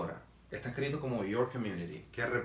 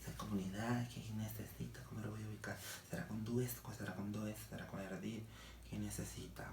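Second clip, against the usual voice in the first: much softer, thinking aloud; muttered self-questioning; 9 dB quieter than usual. Acted. Teacher acts out a student wondering how to ask, muttering softly. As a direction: puzzle it out under your breath. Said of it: "pero empiezo".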